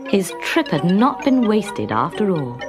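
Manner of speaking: usual speaking voice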